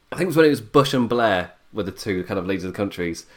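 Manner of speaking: normal talking voice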